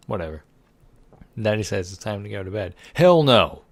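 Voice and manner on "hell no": loud, higher defiant yell; defiant shout. Pretend. Player refuses daddy's bedtime order, loud defiant yell. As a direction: shout the refusal with full force.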